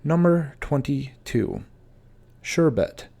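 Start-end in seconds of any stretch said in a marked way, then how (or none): 2.4-3.1 sherbet said SHER-bet; correct pronunciation. Shown as a demonstration. Teacher showing viewers the right way. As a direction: say sherbet with one r. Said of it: "often mispronounced as sorbet or".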